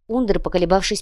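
ordinary delivery, nothing marked

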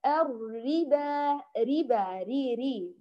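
الربا slowed right down, first ر heavy, then ري thin; mock wrong reading. Shown as a demonstration. Teacher demonstrating the wrong mixed ر, very slow. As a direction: imitate heavy ر in ربا and light ر in ري. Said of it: "فيفخم الراء الأولى".

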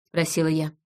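ordinary delivery, nothing marked

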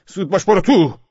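high, loud command; shouted order. A narrator's reading. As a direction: yell at the soldier to hurry. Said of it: "سر چراغ علی داد زدم". (فرمان با داد)

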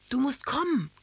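excited high-pitched call to someone. A narrator's reading. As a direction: call out urgently, pitched well up. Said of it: "rief er durchs Telefon".

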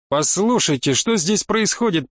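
ordinary delivery, nothing marked

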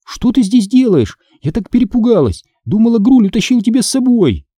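high, worried reproach; agitated. A narrator's reading. Sister finds her hiding brother, agitated reproach.

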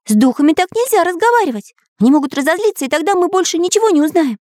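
high, quick, anxious cartoon voice; frightened artist character. Artist warns spy not to anger spirits, high alarmed voice. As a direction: warn hurriedly in a high voice.

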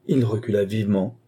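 ordinary delivery, nothing marked

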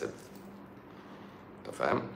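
slow, breathy whisper, almost no voice; whispered aside. Real, not acted. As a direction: lean in and whisper the question very slowly. Teasing aside to the listener. (همسة بطيئة جدًا كأنه سر)

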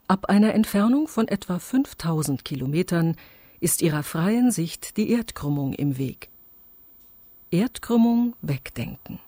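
ordinary delivery

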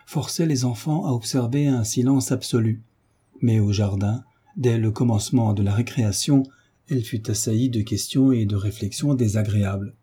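plain reading voice

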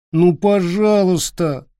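put-on slow, begging child voice; pleading boy. A narrator's reading. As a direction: draw out the plea like a boy. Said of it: "сказал Питер".

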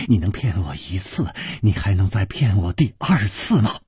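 low, restrained scorn; cold sneer. A narrator's reading. Shi Guanyin sneering at the trickster Chu Liuxiang, cold mild scorn. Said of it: "只听石观音冷笑着说".

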